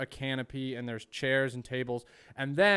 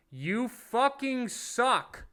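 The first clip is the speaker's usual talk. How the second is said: slow, high, drawn-out yell of you fucking suck; angry heckle; 10 semitones higher, 3 dB louder, much slower than usual. Retold or imitated. Comic yelling back at a heckling comedian, angry. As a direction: heckle him hard and slow, pitch way up.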